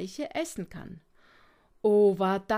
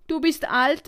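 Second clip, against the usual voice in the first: loud, higher-pitched retort; indignant; 4 semitones higher, 7 dB louder than usual. A narrator's reading. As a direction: protest like an outraged youngster. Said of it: "empörte sich Fips".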